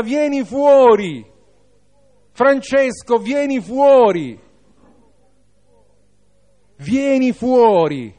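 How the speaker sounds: high, ringing call by name; impassioned calling out, drawing out the last words; loving cry. Acted. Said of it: "sta gridando di amore".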